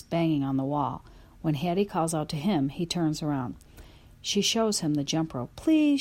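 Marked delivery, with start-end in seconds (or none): 5.5-6.0 high pleading please; begging. A narrator's reading. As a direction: beg like a child. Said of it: "she begs".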